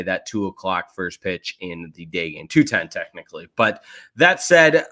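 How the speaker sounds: normal talking voice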